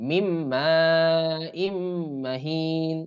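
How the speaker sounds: slow recitation with মা held very long; idgham into mim. Shown as a demonstration. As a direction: hum the doubled m in মিম মা and ইম মাহিন. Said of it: "ইদগাম করে পড়তে হয়".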